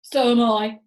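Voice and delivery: loud alarmed cry. Acted. Mister Twit cries out to his wife, frightened. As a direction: call out in a fright. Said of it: "cried Mister Twit".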